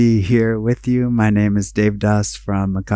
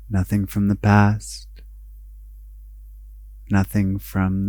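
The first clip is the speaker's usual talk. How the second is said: guided meditation; 6 dB quieter than usual. Acted. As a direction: guide them inward, unhurried, letting each phrase settle.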